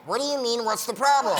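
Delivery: indignant helium squeak. Acted. Patient answering the admitting nurse, indignant helium voice. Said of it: "with the helium voice".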